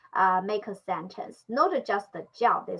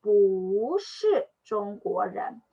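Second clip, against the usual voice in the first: slow model reading; much slower than usual. Shown as a demonstration. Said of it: "when we read this, just like".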